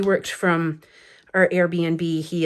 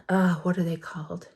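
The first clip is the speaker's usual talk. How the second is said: whisper; 5 dB quieter than usual. Real, not acted. Whispered aside, searching for word.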